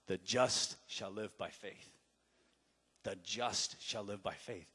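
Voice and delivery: low, soft inner voice; voice of God. Retold or imitated. God answering the praying preacher, low and hushed. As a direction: let God's word settle low and quiet.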